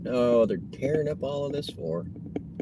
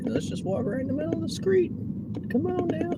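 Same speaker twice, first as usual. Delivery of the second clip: much higher mock voice of careless walkers; mocking pedestrians; 4 dB quieter than usual. Driver mocking people walking in the street in a much higher voice. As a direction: mimic the jaywalkers sarcastically.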